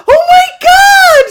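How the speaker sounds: high, loud drawn-out shriek; retold scream. Storyteller imitating the neighbor running out screaming, panicked shriek. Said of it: "screaming and running".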